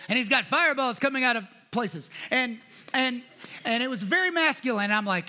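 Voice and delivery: usual speaking voice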